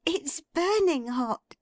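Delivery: high, delighted exclaim; thrilled to share the surprise; excited cry. A narrator's reading. Meg cries out delightedly to her father. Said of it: "cried Meg".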